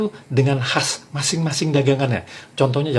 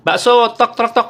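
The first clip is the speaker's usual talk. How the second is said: high, loud call with knocks; mock meatball-seller shout; 8 semitones higher, 5 dB louder than usual. What the meatball seller never does, shouting over his knock. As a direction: shout the name, then knock by mouth. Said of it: "Kagak pakai teriak tuh Kang Bakso".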